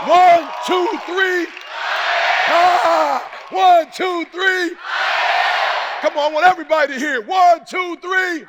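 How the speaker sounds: high, loud, driving preacher shout; full-force preaching. Real, not acted. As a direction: rouse the whole room to stand.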